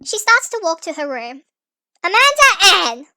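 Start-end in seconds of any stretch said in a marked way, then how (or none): 2.0-3.1 loud, slow stern call; stern full-name call. Acted. Dad calling his daughter back by her full name, loud and stern. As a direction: summon her like a strict parent.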